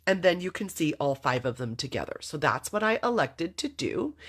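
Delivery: normal talking voice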